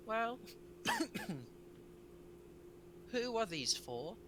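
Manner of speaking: put-on high, slow little child with a cough; small child voice. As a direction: talk like a tiny boy hidden on a throne. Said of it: "the voice of, like, a small child".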